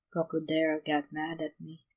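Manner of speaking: low sulky mutter. A narrator's reading. Yamamoto mutters to Chuna about Gokudera, softly. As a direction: mumble it, embarrassed. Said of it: "the rain guardian muttered".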